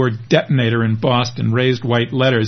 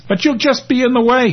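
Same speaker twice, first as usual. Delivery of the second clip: much higher, whining plea; pleading; 12 semitones higher than usual. Acted. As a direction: beg her to stay behind. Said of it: "he pleads".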